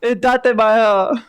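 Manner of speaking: slow, high mock anime shout; anime hero catchphrase. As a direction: stretch datebayo out like Naruto. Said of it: "I'm an anime protagonist".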